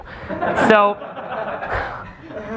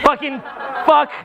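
higher-pitched mock fury over the ruined video; demo rant; 6 semitones higher than usual. Shown as a demonstration. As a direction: rant about the lost video in a raised, whining pitch. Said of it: "talking about weird shit that pisses you off how I did".

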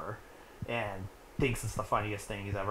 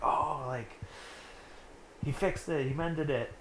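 lightly imitated children's excitement, pitched up; excited kids; 5 semitones higher, 3 dB quieter than usual. Kids seeing their fixed kite, mildly acted excitement. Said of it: "they're all excited".

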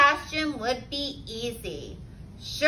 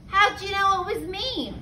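higher-pitched mild moan; 6 semitones higher, faster than usual. A narrator's reading. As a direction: moan it as a found-out turkey. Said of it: "moaned Turkey".

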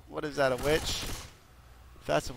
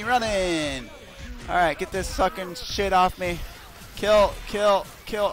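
usual speaking voice

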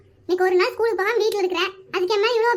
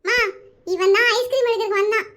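ordinary delivery

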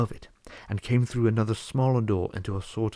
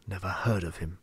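a low, softer growl; 3 semitones lower, 5 dB quieter than usual. A narrator's reading. Huge man dismissing the wizard's name, low growl. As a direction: brush the name off with a grumble. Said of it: "growled the man".

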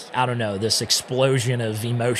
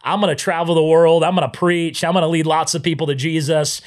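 put-on cocky young self; 5 semitones higher, 5 dB louder than usual. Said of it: "prideful eighteen-year-old only child".

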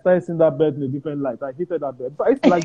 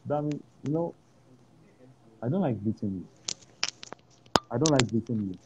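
gentle father; 2 semitones lower, 6 dB quieter than usual. Retold or imitated. Father telling his son he dislikes beating him, softly. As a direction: speak gently like a sorry father. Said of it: "he said".

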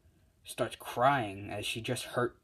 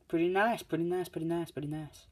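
sing-song teaser; 7 semitones higher than usual. Real, not acted. Teasing next video.

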